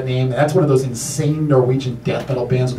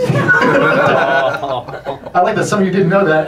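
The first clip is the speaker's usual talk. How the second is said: ordinary talk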